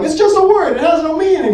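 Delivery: high, mocking voice of a careless person; mocking dismissive voice. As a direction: lift the voice high to mock their excuse. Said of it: "they'll be like".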